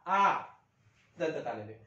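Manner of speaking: ordinary delivery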